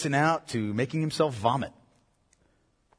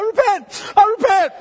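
put-on shrill, loud child begging; pleading child; 16 dB louder than usual. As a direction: squeal like a kid about to be spanked. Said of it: "it's like".

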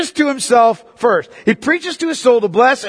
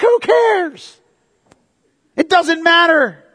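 exasperated; 7 semitones higher, slower than usual. Real, not acted. Dismissing the dress argument again.

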